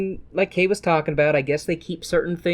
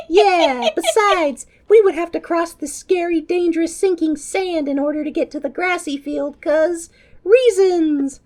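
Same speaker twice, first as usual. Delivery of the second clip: put-on whiny kid voice; 13 semitones higher, 4 dB louder than usual. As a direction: whine excuses like a child. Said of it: "And the others are like".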